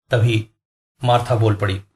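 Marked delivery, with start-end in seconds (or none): none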